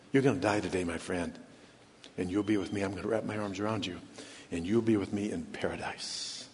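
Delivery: very soft, low comforting voice; hushed, intimate promise to a dying friend; quietly console, letting the voice sink; tender reassurance. Retold or imitated. Voicing Jesus comforting the dying thief.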